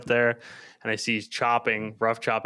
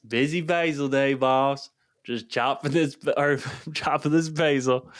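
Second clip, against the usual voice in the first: put-on Southern accent, a bit higher, laughing through it; thick Southern accent; 4 semitones higher than usual. Retelling the new guy's words again, Southern accent with laughter. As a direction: replay the Southern guy's line with a chuckle. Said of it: "Like, a really thick Southern accent".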